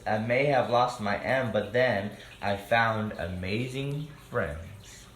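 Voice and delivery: lively rhyming storybook read-aloud; reading to children. A narrator's reading. Host reading a picture book to children, lively. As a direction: read the rhymes out to the kids.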